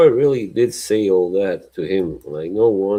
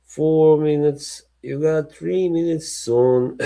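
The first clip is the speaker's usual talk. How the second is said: Brian Clough; 8 semitones higher than usual. Retold or imitated. Manager counting down to goalkeeper, imitated warning. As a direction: count down like an impatient manager, pitched up. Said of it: "then he started going".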